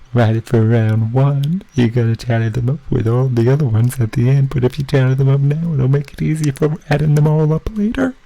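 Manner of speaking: lightly silly put-on voice, hungry and delirious; weird voice. Hungry quiz host getting silly, mildly put-on voice. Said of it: "I'm gonna stop being weird".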